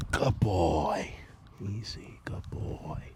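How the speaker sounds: whispered reassurance, very soft; soft whisper to horse. Real, not acted. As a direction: hush him calmly in a whisper. Soothing horse while mounting.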